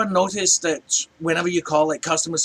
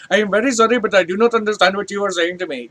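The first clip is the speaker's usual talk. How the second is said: put-on higher, louder Indian-accented apology; mock Indian accent; 4 semitones higher, 4 dB louder, faster than usual. Support agent failing to understand a Scottish caller, Indian accent. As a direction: apologise in an imitated Indian call-centre accent. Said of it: "I actually got the accent down pretty good".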